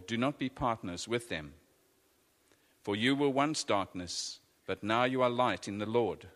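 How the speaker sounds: usual speaking voice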